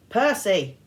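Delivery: loud urgent call. A narrator's reading. Friend calling to Percy from behind, loud. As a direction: call his name to break the spell.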